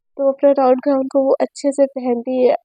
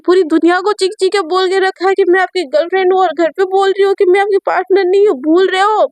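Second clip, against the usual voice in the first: higher, mock-offended girlfriend; Sejal's voice; 4 semitones higher than usual. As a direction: complain in a higher, hurt, teasing tone. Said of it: "तो सेजल बोलती है".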